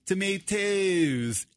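slow British "Tomatoes" with a long ah; drawn-out British pronunciation of "Tomatoes"; British accent. Retold or imitated. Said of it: "I can hear him saying".

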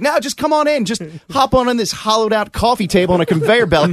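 high breezy mock operator; carefree ride operator. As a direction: toss it off like nothing's wrong. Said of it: "They're like".

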